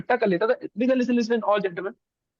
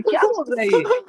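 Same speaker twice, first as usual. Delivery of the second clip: higher, baffled mock question; puzzled officer; 8 semitones higher, 4 dB louder than usual. Interviewer reacting to a strange answer, baffled and higher. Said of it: "वो तो वो ऐसे कर रहे हैं".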